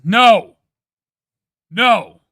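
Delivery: loud, exasperated no; emphatic refusal. Real, not acted. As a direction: shut it down loudly. Rejecting the added S loudly.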